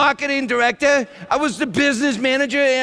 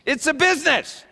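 ordinary talk

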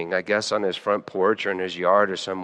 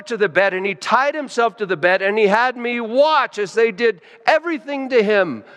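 higher and louder, rushing with excitement; excited retelling; 7 semitones higher, 5 dB louder than usual. Retold or imitated. Friend calling the pastor about her dream, excited high voice. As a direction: tumble out the dream, thrilled and breathless. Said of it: "she called me really excited".

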